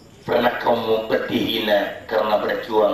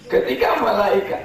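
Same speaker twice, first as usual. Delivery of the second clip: high, louder call; raised for emphasis; raised preaching voice; 12 semitones higher, 4 dB louder than usual. Real, not acted. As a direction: lift the voice to the hall. Preacher raises voice mid-sermon.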